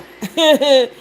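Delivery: brief real chuckle; a small, knowing laugh; short chuckle. Real, not acted. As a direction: chuckle to yourself in disbelief. Reacting to propaganda she mocks.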